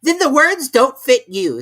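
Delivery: high, loud pleased king; grinning at his own joke; smiling king. A narrator's reading. The King to the court, high and pleased. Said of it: "said the king, looking round the court with a smile".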